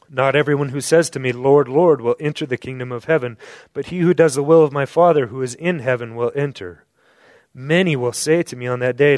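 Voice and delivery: loud, fast, driving scripture reading; preaching scripture. Acted. Preacher reads Jesus' warning to the congregation, loud. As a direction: thunder the verse out to the room.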